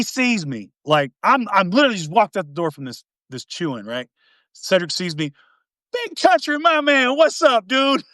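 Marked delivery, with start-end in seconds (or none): 5.9-8.0 high, exuberant imitated hail; excited greeting. Cedric spotting his buddy outside the office, excited high greeting. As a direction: mimic a buddy delightedly calling out. Cedric hails him cheerfully in story.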